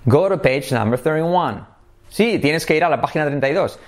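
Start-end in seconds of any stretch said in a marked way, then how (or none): 0.0-1.6 "go to" run together as "goro"; all linked together. Shown as a demonstration. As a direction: join "Go to page" into one smooth unit. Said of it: "cómo se diría todo unido".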